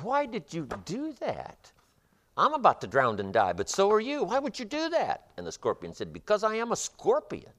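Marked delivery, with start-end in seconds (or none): 0.0-5.3 a doomed frog asking why; much higher dying frog, stunned; disbelieving frog. Acted. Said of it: "The frog cannot believe what's happened". 6.1-7.5 matter-of-fact scorpion. Acted. Scorpion's fatal answer.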